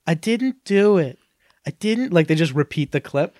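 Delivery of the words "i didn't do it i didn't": put-on higher, slow whiny kid denial; little boy denying. Boy on the naughty list video denying it, whiny. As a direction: plead innocence like a caught child. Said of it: "the little boy goes".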